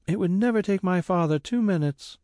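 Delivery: put-on a hopeful girl sure of her father; young Grace. A narrator's reading. Grace answers the carrier, eager young girl voice.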